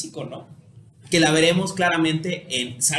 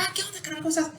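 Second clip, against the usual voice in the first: higher, breezy hey-buddy voice; lightly voiced carefree buddy; casual friendly greeting; 10 semitones higher, 4 dB quieter, faster than usual. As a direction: greet an old pal like nothing happened. Greeting a friend you were angry with.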